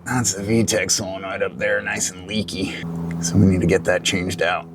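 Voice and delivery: talking while lying under a car. Real, not acted. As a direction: voice from flat on your back under a car. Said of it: "Okay, so I'm under the car".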